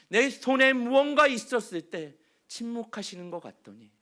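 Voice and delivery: ordinary talk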